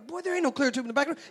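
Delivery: high, very fast mock-mother retort; arguing mom. Mother contradicting her son in church, high rapid scolding. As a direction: rattle off the denial way up high. Mother argues back at child.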